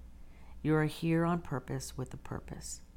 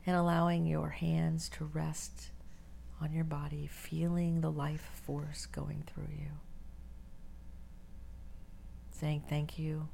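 guided meditation; 4 dB quieter than usual. Acted. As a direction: ease them into stillness, breathy and unhurried.

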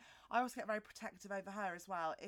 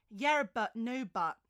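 catchphrase quote; 7 dB louder, slower than usual. Retold or imitated. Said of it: "her quotes".

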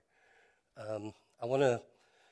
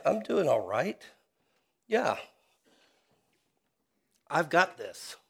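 smug self-assurance; 3 semitones higher, 5 dB louder than usual. Retold or imitated. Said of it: "self-righteous hypocrisy which says".

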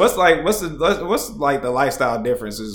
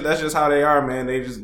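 usual speaking voice

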